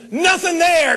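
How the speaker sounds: high, loud verdict shouted across the boat; loud call. Retold or imitated. Richard Petty calling out to the crew that no fish are there, loud. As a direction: shout it out and move on. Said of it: "He says".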